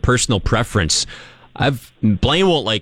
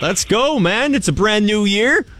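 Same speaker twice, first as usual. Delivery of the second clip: excited greeting; 7 semitones higher than usual. Real, not acted. Greeting guest for new year.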